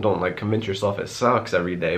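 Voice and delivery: ordinary talk, nothing marked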